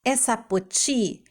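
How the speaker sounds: ordinary talk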